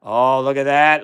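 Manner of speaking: usual speaking voice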